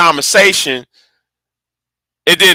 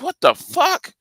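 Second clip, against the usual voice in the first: shocked reaction; 10 semitones higher, 6 dB quieter than usual. Retold or imitated. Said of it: "I'm like".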